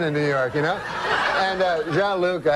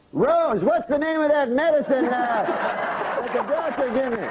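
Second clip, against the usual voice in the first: high, raised-pitch call for help remembering; calling out to Rose; 8 semitones higher, slower than usual. Acted. Forgetful uncle asks Rose for the medicine's name, calling out high. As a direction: call across the house, pitched way up.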